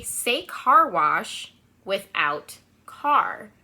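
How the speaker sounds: very slow, clear teacher prompt; slow, deliberate classroom direction; slow instruction. Shown as a demonstration. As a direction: spell out each word for little kids. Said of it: "[name] is gonna say".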